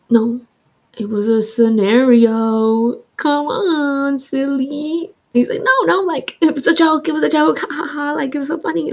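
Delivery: put-on high, dismissive boyfriend voice; mock ex laughing it off. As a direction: brush it off as a joke.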